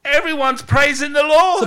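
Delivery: loud, high celebratory proclamation; jubilant preaching. Real, not acted. Preacher picturing Israel's victory to the congregation, loudly jubilant. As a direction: proclaim it at full force. Celebration after the Red Sea.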